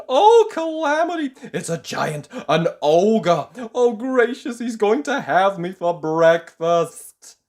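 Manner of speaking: high, loud frantic babbling; panicked squeal. Acted. Mister Jelly fearing the giant will eat him, panicked. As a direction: gabble in terror.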